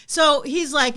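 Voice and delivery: ordinary talk, nothing marked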